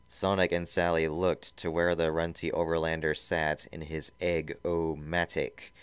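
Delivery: usual speaking voice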